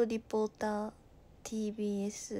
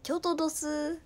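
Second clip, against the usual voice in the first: mock Kyoto dialect; 6 semitones higher, 3 dB louder than usual. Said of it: "京都です。".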